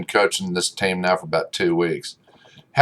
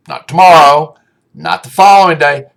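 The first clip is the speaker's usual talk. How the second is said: stern coach yell; 5 semitones higher, 6 dB louder than usual. Retold or imitated. Coach chewing out pitcher.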